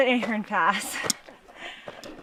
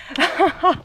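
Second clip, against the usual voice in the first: delighted laugh; 8 semitones higher than usual. Real, not acted. Delighted by the sheep.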